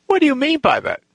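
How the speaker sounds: high-pitched affronted question; imitating a riled gang leader; acted high, challenging retort; indignant demand.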